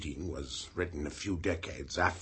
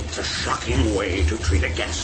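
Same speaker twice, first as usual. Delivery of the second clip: angry scolding; 4 semitones higher, 9 dB louder than usual. Acted. Abbot scolding the inventor.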